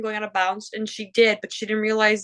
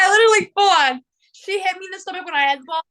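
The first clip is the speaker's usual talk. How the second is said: excited; 8 semitones higher, 5 dB louder, faster than usual. Real, not acted. Retelling the fight, worked up.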